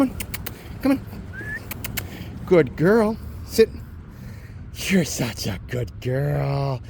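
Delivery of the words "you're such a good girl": talking to a dog. Real, not acted. Praising the dog.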